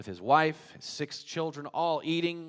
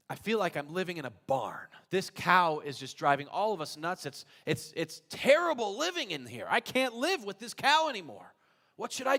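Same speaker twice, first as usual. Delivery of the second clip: higher, louder fed-up plea; exasperated complaint; 5 semitones higher, 5 dB louder than usual. Acted. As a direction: sound at the end of your rope. Man complains about the cow.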